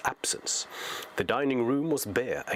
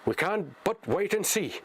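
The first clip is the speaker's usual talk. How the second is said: lightly voiced hobbit, higher, shrugging; Sam resigned; 8 semitones higher than usual. Sam sits by Frodo, higher resigned voice. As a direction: make the best of it, calmly resigned.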